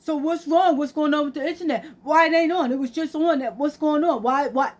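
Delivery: high, urgent stream of questions; imitating a son in a panic; agitated son. Acts out son fretting about internet.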